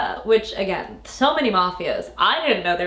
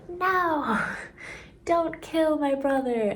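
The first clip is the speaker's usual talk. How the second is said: mock pleading cry; 8 semitones higher, 4 dB quieter, slower than usual. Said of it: "storms into the room".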